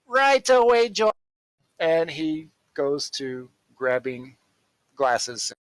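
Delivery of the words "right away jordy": loud, higher robot reply; robot servant. Acted. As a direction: acknowledge the order as a robot. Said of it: "As the, uh, robot".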